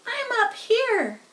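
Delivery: high, slow little voice from above; a tiny spider greeting the pig from above. A narrator's reading. Tiny spider calling down to Wilbur, high and slow. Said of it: "a small voice".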